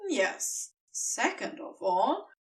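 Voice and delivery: plain reading voice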